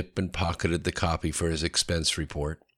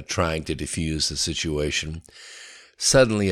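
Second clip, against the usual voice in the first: usual speaking voice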